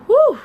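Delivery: high, loud whoosh of breath; loud shaky exhale. Real, not acted. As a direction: let out a big pained whew. Exhale before painful confession.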